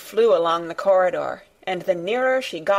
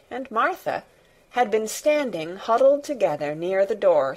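plain reading voice

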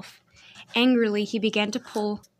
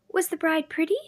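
put-on young girl voice; 8 semitones higher than usual. A narrator's reading. Girl's question.

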